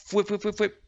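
quick, high "fwip" sound effects; short whistly whooshes in a row; imitated arrow whizzes. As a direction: make the noise of four arrows flying. Imitates four arrows flying.

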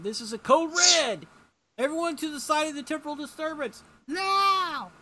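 high, loud mock alarm announcement; Superman. Superman ordering everyone out over the microphone, urgent shout.

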